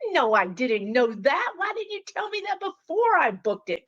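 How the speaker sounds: indignant disbelief rising high at the end; shocked protest. Retold or imitated. To her spirit guides, a high shocked protest. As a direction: sputter in shocked outrage. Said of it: "And I'm like".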